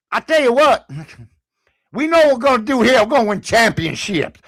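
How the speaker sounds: mock Southern coach talk; Brian Kelly's Southern accent. Host mocking coach's first day, put-on Southern accent. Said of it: "this guy, the first day".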